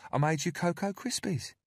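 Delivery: high, sugary caretaker voice; falsely sweet and cajoling; sweet coaxing woman. A narrator's reading. Narrator voices Candy.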